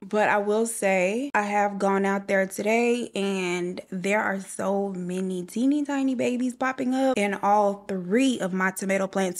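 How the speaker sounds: ordinary talk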